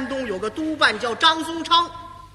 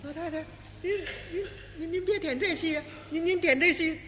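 much softer, stammering apology; nervous stage manager placating the warlord's mother; fearful manager stammering; 4 semitones higher, 6 dB quieter than usual. Acted. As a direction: beg her not to be angry, barely daring to speak. Manager pleads with the old lady.

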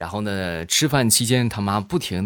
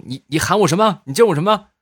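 higher, louder trembling outrage; trembling with rage; 9 semitones higher, 6 dB louder than usual. Acted. Furious father asks his son what he called him. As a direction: sputter it out shaking with anger. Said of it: "当时就发抖".

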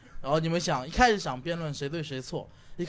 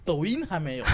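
usual speaking voice

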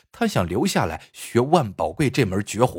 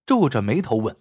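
usual speaking voice

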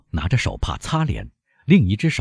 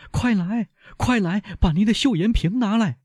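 very high, frantic plea; strained high-pitched summons; urgent calling; 14 semitones higher than usual. A narrator's reading. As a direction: cry out for someone to hurry with help. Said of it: "大声叫她的继母".

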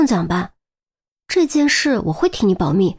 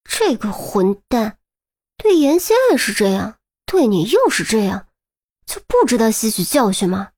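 seethe on someone else's behalf; angry grumbling with rising emphasis; fuming through gritted teeth. A narrator's reading. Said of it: "气得直咬牙".

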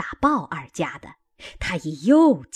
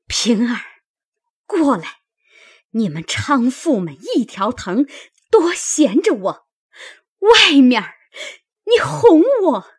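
scolding that builds higher and louder; angry cursing; 4 semitones higher, 6 dB louder than usual. A narrator's reading. Wife turning on her maid as a conspirator, angry cursing. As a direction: escalate into a loud, high accusation. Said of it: "堵着门站着骂道".

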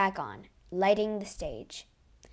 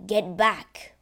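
loud, threatening warning; 8 dB louder than usual. A narrator's reading. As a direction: warn him off sharply. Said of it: "warned the phantom".

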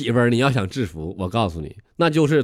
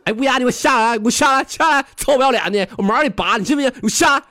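high-pitched mock-furious demands; angry challenge; 9 semitones higher than usual. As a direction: call the crow down for a fight. Farmer orders crow down, acted quarrel.

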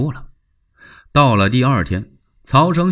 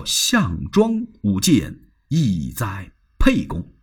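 solemn saying; slower than usual. Acted. Cao Cao quotes the old saying.

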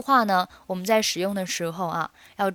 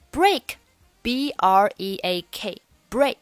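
spelled out slowly; much slower than usual. Shown as a demonstration. Teacher spelling break slowly for students. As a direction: spell break letter by letter, very slow. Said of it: "spelling the word out".

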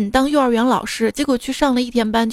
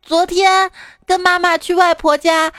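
put-on tattling little boy; 7 semitones higher than usual. Pupil reports classmate to teacher, tattling child voice. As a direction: tell on someone in a kiddie voice. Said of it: "小明同学向老师告状".

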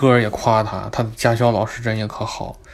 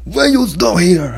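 annoyed examiner; 7 semitones higher, 6 dB louder, slower than usual. Retold or imitated. Examiner scolds his stopping.

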